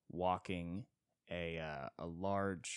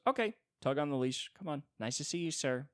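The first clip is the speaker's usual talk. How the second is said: higher, politely brisk lady; a rich lady easing away politely; 7 semitones higher, 3 dB louder than usual. Retold or imitated. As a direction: tug the leash and excuse yourself nicely. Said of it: "the lady goes".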